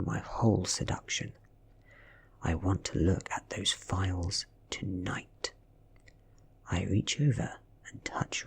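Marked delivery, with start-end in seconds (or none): none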